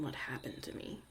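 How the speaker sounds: a low whisper, musing to herself; hushed, wry repeat of the question; whispered echo. Acted. Echoing the listener's question back.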